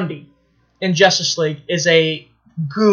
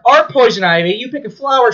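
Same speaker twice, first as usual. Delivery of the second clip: ordinary delivery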